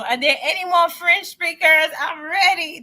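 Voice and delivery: high-pitched, eager call to the audience; rally the viewers, thrilled and raring to go; excited call-out. Real, not acted. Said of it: "I just got so excited".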